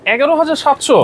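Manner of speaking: high surprised echo of the price. Real, not acted. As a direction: sound amazed at the deal. Host reacts to low price. (দাম শুনে অবাক প্রশ্ন)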